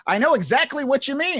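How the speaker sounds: eager agreement. Acted. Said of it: "start agreeing with him".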